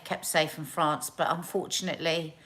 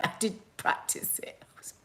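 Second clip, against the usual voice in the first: laughing whisper; 2 semitones higher, 8 dB quieter than usual. Real, not acted.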